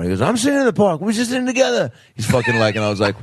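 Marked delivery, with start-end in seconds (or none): 0.2-1.9 put-on much higher, slurred drunk rocker. Drunk rock star invites the teen to sing, high and slurred. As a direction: ham up a wasted singer inviting you along. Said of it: "making him sound like a drunk [name]".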